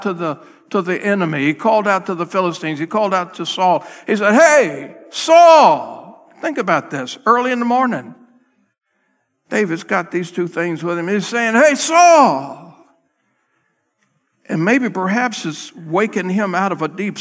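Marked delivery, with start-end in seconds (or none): 4.3-6.3 loud call across a gorge. Retold or imitated. David calling out to Saul across the gorge, loud call. 11.5-12.7 high loud call. Retold or imitated. David calling to the sleeping camp, loud high call. Calling across the gorge to Saul.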